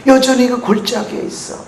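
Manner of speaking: usual speaking voice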